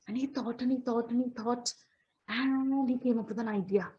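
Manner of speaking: usual speaking voice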